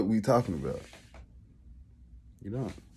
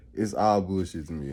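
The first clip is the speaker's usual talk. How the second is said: ordinary talk, nothing marked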